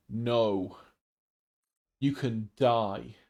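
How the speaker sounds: slow, deadpan mock doctor. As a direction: say it flat and slow like a blunt doctor.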